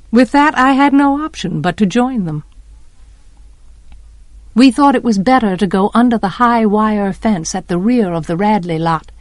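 plain reading voice

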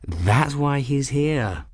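slow, dry patient nobleman; patient lord. Acted. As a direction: speak like a weary, polite lord. Measured lord Albion.

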